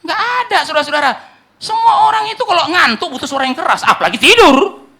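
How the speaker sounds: high, loud, impassioned sermon shout; full-force preaching. Real, not acted. Preacher rousing a sleeping church, full-force preaching. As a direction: cry out to wake a sleeping church.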